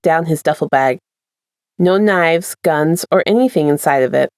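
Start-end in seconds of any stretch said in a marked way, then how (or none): none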